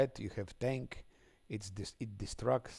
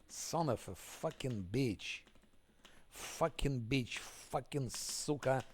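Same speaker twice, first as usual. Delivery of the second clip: slow seething curse; slower than usual. Real, not acted. Dragged-out cursing.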